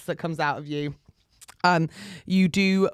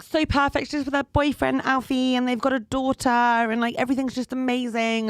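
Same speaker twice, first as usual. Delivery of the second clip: higher-pitched, gushing influencer voice; perfect-YouTuber voice; 4 semitones higher than usual. Acted. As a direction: gush like a picture-perfect lifestyle vlogger. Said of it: "why I'm doing this voice".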